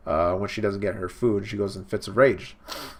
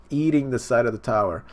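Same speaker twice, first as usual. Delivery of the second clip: ordinary talk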